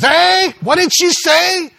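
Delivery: very high, loud, slow husband voice; hard-of-hearing shout. Acted. Husband with dead hearing aid asking his wife, shouting. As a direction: holler it like someone who can't hear.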